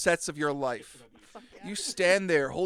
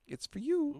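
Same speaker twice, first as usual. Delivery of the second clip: therapist voice, pitched up; high put-on voice; 9 semitones higher, 6 dB quieter, slower than usual.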